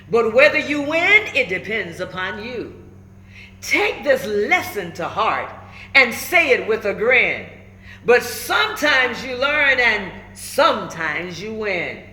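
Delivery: put-on lively, rhyming read-aloud for kids; storybook read-aloud. A narrator's reading. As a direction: bounce the rhymes like a picture book. Reads picture book aloud.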